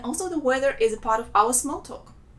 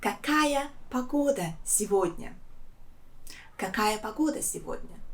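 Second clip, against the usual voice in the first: softer, Какая погода сегодня with a falling question tune; model question intonation; 7 dB quieter than usual. Shown as a demonstration. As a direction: ask Какая погода сегодня gently for students to copy. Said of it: "follow my intonation".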